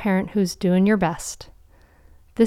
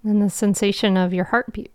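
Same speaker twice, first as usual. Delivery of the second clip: guided meditation. Acted. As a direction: guide them gently into calm.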